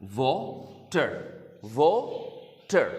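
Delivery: Water drawn out very slowly, each syllable stretched; slow demo word. Shown as a demonstration. Teacher shows children the word slowly, drawn out. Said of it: "ये एक word है".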